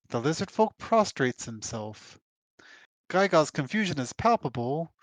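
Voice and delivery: ordinary talk, nothing marked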